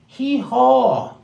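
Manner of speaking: high, loud mock donkey hee-haw; donkey bray.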